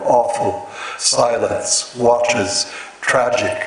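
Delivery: "awful", "silence", "watches", "tragic" each said alone, first syllable hit, falling; stress on first syllables. Shown as a demonstration. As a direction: lean on the front of each word. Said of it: "the stress on the first syllable".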